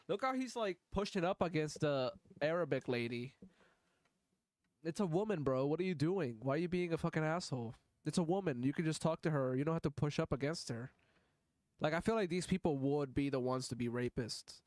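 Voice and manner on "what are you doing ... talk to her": ordinary talk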